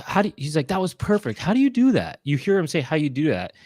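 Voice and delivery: ordinary talk, nothing marked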